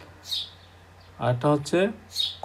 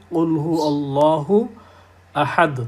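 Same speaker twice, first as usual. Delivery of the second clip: recitation; slower than usual. Acted. Quoting Quran verse as example.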